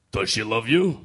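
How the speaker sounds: a hint of a stern Russian father; lightly voiced protective dad grilling; gruff overprotective dad. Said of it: "I'll do my best impression".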